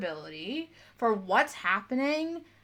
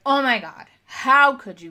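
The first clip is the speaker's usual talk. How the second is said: a hammed-up offended gasp, higher and louder; mock outrage; 4 semitones higher, 9 dB louder than usual. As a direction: play the wounded drama queen, half mocking. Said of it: "it's not up to me or up to you to be like".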